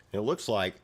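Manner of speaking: usual speaking voice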